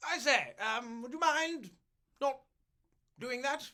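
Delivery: high, prim, fussy royal voice; overly polite meddling monarch; mock posh king. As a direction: James the First butting in, mock polite. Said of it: "he says".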